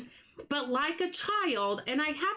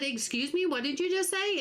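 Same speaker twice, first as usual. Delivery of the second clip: retold surprise; 7 semitones higher, faster than usual. Stunned reply to son's sentence.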